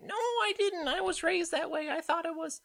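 high, whiny mock defense; high mocking whine of a defensive candidate.

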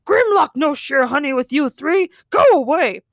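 loud, possessive robot dinosaur; high, loud monster refusal; Grimlock. Acted. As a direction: shoo intruders off as a robot dinosaur. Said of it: "the robotic T-Rex shouted".

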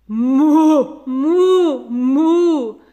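loud long lowing moo; three cows lowing together; cows bellowing. Acted. Cows bellow at the boy, loud drawn-out moo. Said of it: "riefen alle drei auf einmal".

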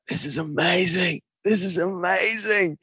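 soft, hushed, almost crying; near tears. Retold or imitated. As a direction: breathe it out, overwhelmed and teary. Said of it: "I was almost crying".

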